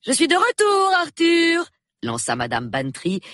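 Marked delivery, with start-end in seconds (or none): 0.0-1.7 high, loud triumphant announcement; royal proclamation. A narrator's reading. Wife announcing her return to her husband, proclamatory. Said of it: "comme s'il se fût agi d'une proclamation royale".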